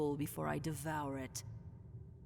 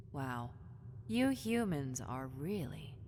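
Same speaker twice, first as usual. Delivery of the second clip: ordinary talk, nothing marked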